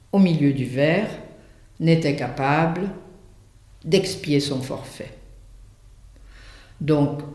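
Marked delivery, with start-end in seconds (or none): none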